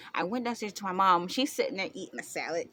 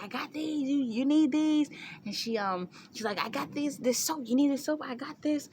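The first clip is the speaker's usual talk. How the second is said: high, eager mock mom offering everything; fussing mom; 7 semitones higher than usual. Mom keeps pushing supplies on her daughter. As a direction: pile on offers like a fussing mother.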